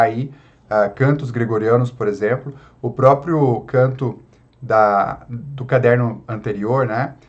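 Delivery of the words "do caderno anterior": usual speaking voice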